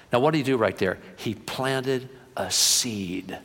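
ordinary talk

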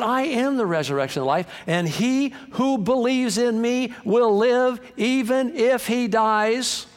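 high, slow, ringing preacher's delivery; scripture proclamation. Acted. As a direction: proclaim it with deliberate, mounting force. Proclaims Jesus' words as scripture.

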